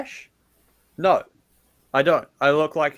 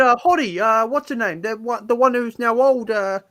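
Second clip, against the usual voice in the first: high Kiwi bloke groping for a name; over-the-top New Zealand accent, hesitating; crazy New Zealand accent; 6 semitones higher, 3 dB louder than usual. Pretend. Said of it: "like, a crazy New Zealand accent".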